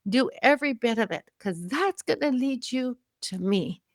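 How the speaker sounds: high, urging and tender; letting her younger self go with love; high, tearful encouragement; emotional blessing. Retold or imitated.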